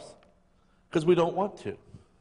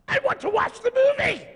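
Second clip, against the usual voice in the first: high shout, much louder; exasperated outburst, pitched way up; fed-up shout; 4 dB louder than usual. Acted. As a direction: yell it in frustration at the critic. Annoyed moviegoer shouting back.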